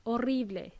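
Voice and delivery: horrible in Spanish, "o-RREE-bleh"; horrible, Spanish r, stressed on the middle; Spanish pronunciation. Shown as a demonstration. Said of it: "in Spanish this is".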